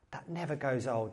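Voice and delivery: ordinary talk, nothing marked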